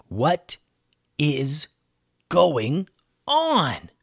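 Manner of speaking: word by word with long pauses; bewildered out loud. A narrator's reading. Bones baffled by the laser-eyed elephants, bewildered out loud. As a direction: leave a gap after each word, rising on the last. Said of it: "said Bones out loud".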